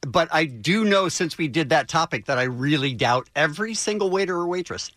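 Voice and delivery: normal talking voice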